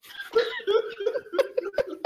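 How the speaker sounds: high helpless laughter; laughing. Real, not acted. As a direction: burst out laughing. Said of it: "Can't even keep a straight face".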